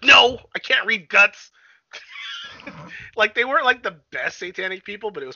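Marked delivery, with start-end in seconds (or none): 0.0-1.5 loud, slow, higher sarcastic retort; a hammed-up indignant reply; mock exasperation. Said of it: "She's like". 1.9-3.1 high, airy burst of laughter; a squealing laugh. Real, not acted.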